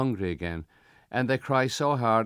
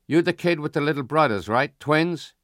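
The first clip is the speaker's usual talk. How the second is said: higher, louder Italian grocer voice; raised-voice Italian shopkeeper; 6 semitones higher, 5 dB louder than usual. Acted. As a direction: question the kid as the Italian grocer. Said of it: "it's the Italian".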